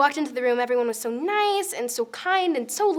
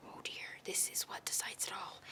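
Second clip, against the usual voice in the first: nervous unvoiced whisper; 20 dB quieter than usual. Girl whispering to herself outside class, nervous. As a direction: breathe it out, scared of the news. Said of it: "And I go".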